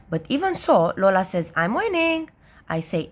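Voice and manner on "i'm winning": put-on high little-girl boast; boastful little sister. A narrator's reading. Little sister tells brother she is winning, boastful. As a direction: gloat like a small child. Lola boasts during the game.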